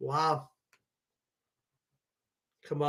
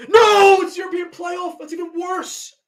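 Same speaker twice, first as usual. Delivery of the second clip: high, loud, slow cry of dismay; dismayed shout; 10 semitones higher, 7 dB louder than usual. Real, not acted. Fan seeing the dreaded playoff team drawn, dismayed shout. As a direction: shout "no" as the feared team arrives.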